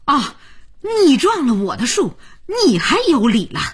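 scolding. A narrator's reading. Grandma's quarrel continues.

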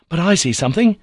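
high, loud eager outburst; impetuous cry. A narrator's reading. Nephew spotting something shining in the sand, impetuous cry. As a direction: blurt it out, thrilled by the find. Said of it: "in an impetuous tone of voice".

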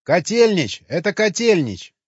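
loud, emphatic call; calling out a discovery. A narrator's reading. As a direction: shout the news to the group. Calls out the sign's name.

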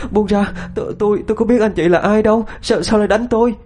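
high-pitched distressed stammer; tearful, sobbing plea. A narrator's reading. Thắm begging her attacker to let go, sobbing in distress. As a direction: plead in a high crying voice. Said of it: "mà khóc lên nức nở".

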